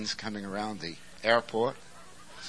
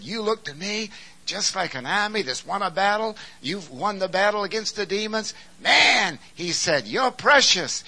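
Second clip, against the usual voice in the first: bridegroom's praise; 11 semitones higher, 8 dB louder than usual. Acted.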